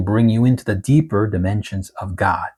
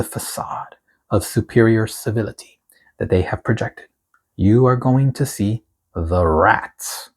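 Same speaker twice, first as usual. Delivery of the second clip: prophetic proclamation; 2 semitones higher than usual. Acted. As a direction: proclaim it like a prophet reading a decree. Reads the prophetic word aloud.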